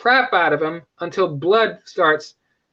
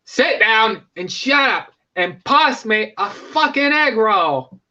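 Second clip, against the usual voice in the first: slow, raised mock Australian snarl; angry Kano; 3 semitones higher, slower than usual. Kano fed up at the dinner table, mock Australian. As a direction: bark it out in a put-on Aussie accent. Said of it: "He's like".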